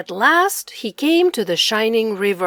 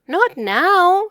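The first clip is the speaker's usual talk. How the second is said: kittens protesting; 7 semitones higher, 6 dB louder than usual. Acted.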